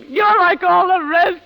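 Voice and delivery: slow, loud, cutting; slow bitter blow; bitter accusation. Acted. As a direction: spit it out slowly.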